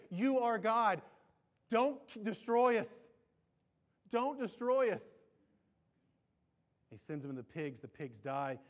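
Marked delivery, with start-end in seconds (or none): 0.1-5.0 louder, higher frantic begging; a terrified demon begging Jesus; pleading demon. Acted. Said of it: "The demon ... He says".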